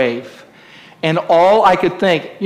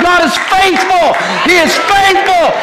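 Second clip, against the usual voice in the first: high loud shouted affirmation; 13 semitones higher, 5 dB louder than usual. Shown as a demonstration. Preacher leading the congregation's shout, high and loud. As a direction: shout it for the church. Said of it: "Somebody shout".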